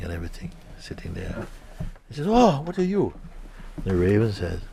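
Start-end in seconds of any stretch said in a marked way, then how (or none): 2.3-3.1 much higher, startled cry; startled question. Acted. The seeker sees the old raven, startled. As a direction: recoil at the strange bird.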